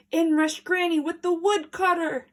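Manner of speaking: ordinary delivery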